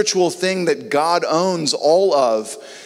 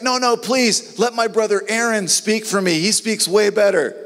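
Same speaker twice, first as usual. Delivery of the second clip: pleading Moses; 7 semitones higher than usual. Retold or imitated. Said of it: "He's like".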